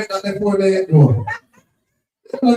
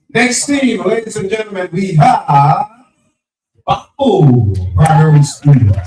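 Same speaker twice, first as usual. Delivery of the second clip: announcer voice; 5 dB louder than usual. Real, not acted. MC announcing next team.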